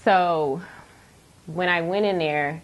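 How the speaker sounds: usual speaking voice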